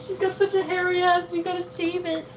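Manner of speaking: put-on baby talk. Talking to the pet, baby voice.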